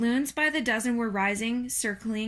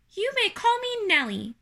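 high little spider voice; tiny creature pitched well up; third little spider; 12 semitones higher, 4 dB louder than usual. Acted. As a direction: grant it in a small, high voice.

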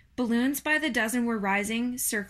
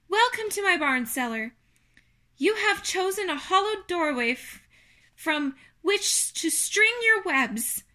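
earnest speech, pitched up and projected; heartfelt ceremonial delivery, higher and louder; Wilbur making a speech; 8 semitones higher, 4 dB louder than usual. A narrator's reading. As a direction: address them solemnly in a high character voice. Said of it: "make a short speech on this very important occasion".

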